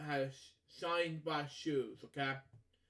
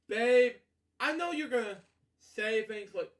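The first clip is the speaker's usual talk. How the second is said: a loud call, higher and louder; 4 semitones higher, 5 dB louder than usual. Real, not acted. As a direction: call Babe by name. Calling out to Babe.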